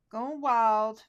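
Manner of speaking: loud, playful exclamation; going wild. Real, not acted. Crafter to viewers as she goes rogue, excited. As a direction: throw it out with gleeful abandon.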